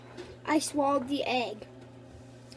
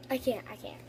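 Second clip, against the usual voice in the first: whispering; 6 semitones lower, 10 dB quieter, faster than usual. Real, not acted. Struggling to eat raw egg.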